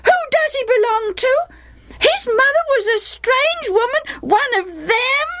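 put-on very high, indignant child voices; indignant boys. A narrator's reading. Half-brothers disowning Jephthah, indignant and high.

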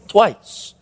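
normal talking voice

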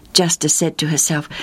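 ordinary delivery, nothing marked

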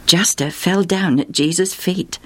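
plain reading voice

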